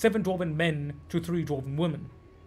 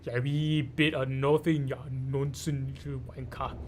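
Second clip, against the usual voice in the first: mock Scottish accent; 5 semitones lower, 4 dB quieter than usual. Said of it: "ended up becoming Scottish".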